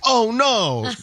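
high whiny mock dismay. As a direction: whimper it like a caught texter. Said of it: "And they're like".

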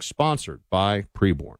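ordinary talk, nothing marked

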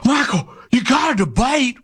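gasp, then a high tearful whine; mock pleading. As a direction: beg like a panicked supporter, hammed up. Mimics a pleading, panicked listener.

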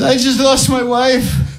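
high, slow, mock-tearful voice; grieving stranger. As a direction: confess the loss tremblingly. Neighbour confides grief.

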